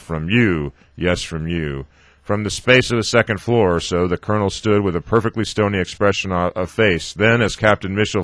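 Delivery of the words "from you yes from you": ordinary delivery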